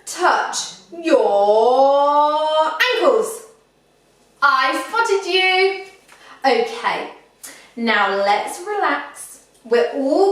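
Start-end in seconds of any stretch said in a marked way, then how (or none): 0.0-3.5 very drawn-out, loud game call; suspenseful trick command. Pretend. 4.4-5.9 high, loud, stretched teasing call; playful gotcha. Pretend. Catching players out in game.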